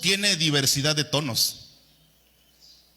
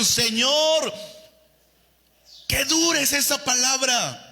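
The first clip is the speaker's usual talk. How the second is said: raised complaint; 9 semitones higher than usual. Retold or imitated. Apostles protesting to the Lord, raised complaint.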